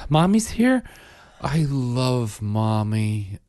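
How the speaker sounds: put-on high, drawn-out little-girl gush; imitated adoring toddler. Dad imitating his daughter greeting mommy, adoring. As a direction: stretch it out like a smitten toddler.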